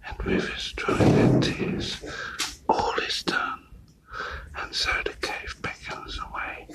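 breathy whispered poem reading. Real, not acted. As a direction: whisper the verse in a public place. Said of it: "I have to whisper".

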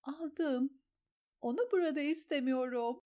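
high, tearful reply; sobbing. A narrator's reading. Sansa sobs her answer to Petyr. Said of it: "diye hıçkırdı Sansa".

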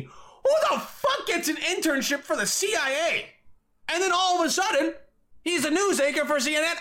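high, incredulous rant; yelling. Real, not acted. Podcaster ranting about a CNN anchor, yelling. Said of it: "Sorry for yelling".

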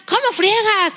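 high-pitched mock exasperation; imitating irritated husband. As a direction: complain like a fed-up husband. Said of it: "alzaba mucho la voz el hombre". (marido fastidiado, imitado)

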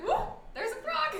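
high, loud startled cry at the frog; retelling the frog landing on her leg, startled; startled whoa. Retold or imitated. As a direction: jump at the sight of a frog.

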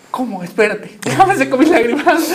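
half-laughing, wiping away tears; mock tearful surprise. Shocked daughter to her mother, teary disbelief. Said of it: "de todas mis lágrimas".

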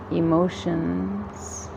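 ordinary talk, nothing marked

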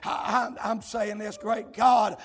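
loud, driving revival preacher delivery; shouted sermon cadence; full-force preaching. Real, not acted. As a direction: preach it out hard, phrase by phrase.

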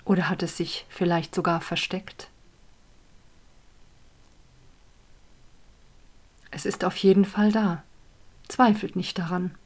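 calm, unhurried guiding voice; guided meditation. Acted. Leads a meditation.

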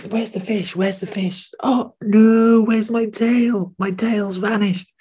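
very high crying rabbit voice, slowing; high sobbing rabbit, slow at the end; distraught rabbit. Acted. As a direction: wail it like a rabbit who lost her tail. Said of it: "Rabbit says".

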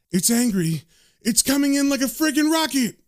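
rising into a high, urgent cry; alarmed warning. Acted. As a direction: build from tense report to acted panic. Medium warns of demon rushing in.

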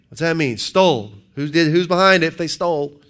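ordinary delivery

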